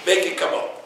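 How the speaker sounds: ordinary delivery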